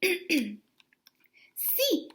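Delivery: hushed breathy sí; whisper. A narrator's reading. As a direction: breathe it out unvoiced. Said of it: "said Poquito Tito".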